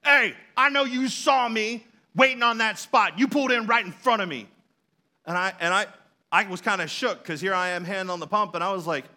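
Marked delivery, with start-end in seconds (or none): 0.0-4.5 retold angry yell. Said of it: "this guy yells at me".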